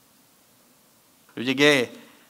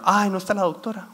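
higher-pitched imitation of a lady at a counter; lightly voiced clerk lady; 6 semitones higher than usual. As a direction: lightly mimic a woman breaking unwelcome news. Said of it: "y me dice la señora".

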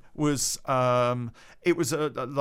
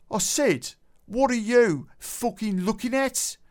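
angry bloke; 9 semitones higher, 3 dB louder than usual. Retold or imitated. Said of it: "the bloke went".

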